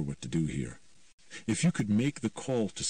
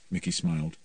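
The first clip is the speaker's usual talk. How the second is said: plain reading voice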